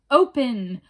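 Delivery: loud witch's shout at the door; first Open! loud, not yet at full scream; screamed command. Acted. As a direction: bang out the word Open! as a demand. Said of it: "they screamed".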